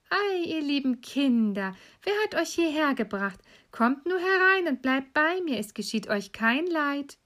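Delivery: put-on high, singsong old woman coaxing; falsely friendly old witch. Old witch luring lost children inside, falsely friendly. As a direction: lure them in with a high, sugary welcome. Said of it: "hatte sich aber nur so freundlich angestellt".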